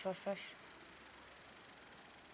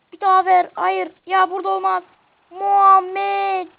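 frustrated yelling; 8 semitones higher, 13 dB louder than usual. Real, not acted. Yelling at player during attack.

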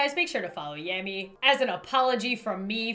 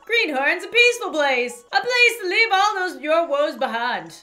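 slightly raised, lilting character voice; lightly voiced townsperson; 6 semitones higher, 6 dB louder than usual. Villager praising the peaceful town, lightly put-on voice. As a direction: read it as a welcoming villager.

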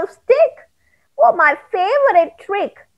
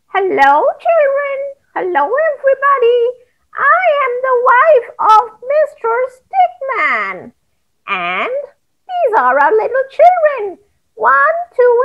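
high wife voice; 8 semitones higher than usual. Acted.

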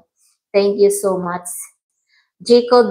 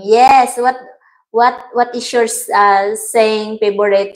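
usual speaking voice

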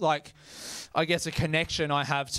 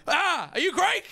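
much higher, eager mock bro voice; excited Greek dude; 13 semitones higher than usual. Greek dude quizzing the comedian, squeaky high excitement. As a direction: pitch it way up like an excited Greek guy. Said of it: "They're like".

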